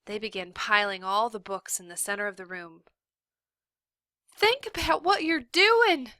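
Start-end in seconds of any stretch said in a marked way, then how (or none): 4.3-6.1 much higher, loud desperate cry; teacher crying out to the mob. A narrator's reading. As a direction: plead with the mob at full voice. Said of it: "cried Miss Catherine".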